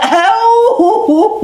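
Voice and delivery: a sudden high cackle; high laugh. Acted. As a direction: burst into laughter. Raccoon laughs at blinded wolf.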